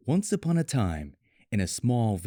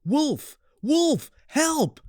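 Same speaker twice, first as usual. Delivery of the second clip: shouting for help; much slower than usual. A narrator's reading. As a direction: yell it out to fool the village. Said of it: "and then shouted".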